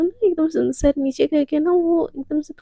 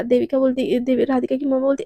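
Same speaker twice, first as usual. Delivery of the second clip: ordinary delivery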